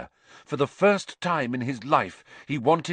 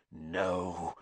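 low, much softer hiss; 5 semitones lower, 9 dB quieter than usual. A narrator's reading. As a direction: breathe the word out as a threat. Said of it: "he hissed".

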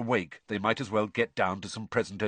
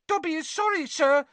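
much higher squeaky elf voice, nervous; anxious squeak. A narrator's reading. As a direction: squeak it like a fretful house-elf. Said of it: "squeaked Dobby anxiously".

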